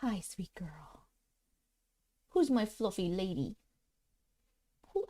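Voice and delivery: soft whisper to a pet; whispered to the cat. Real, not acted. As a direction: hush and coo at the cat. Cooing to her cat.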